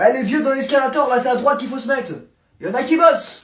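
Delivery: loud commuter's telling-off; irritated shout. Acted. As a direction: holler at people blocking the way. Commuter yelling at slow people ahead.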